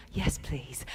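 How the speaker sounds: tiny low whisper; whispered reply. Pretend. Inner chimp accepting an offer to leave the meeting, whispered. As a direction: answer under your breath. Chimp answers in one's head.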